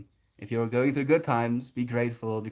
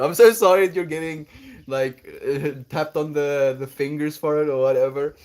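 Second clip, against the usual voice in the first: loud fast apology; 4 semitones higher, 7 dB louder than usual. Real, not acted.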